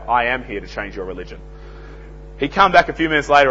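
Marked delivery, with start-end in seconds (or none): 0.1-1.4 low, soft retort with AM set against "not"; understated, offhand punchline; sly comeback. Retold or imitated. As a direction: slip it in casually, dropped right down. His comeback to the salesman's line.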